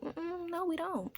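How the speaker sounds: high, soft mock-innocent denial; small, high-pitched quoted denial. Voices staff denying the extra patient.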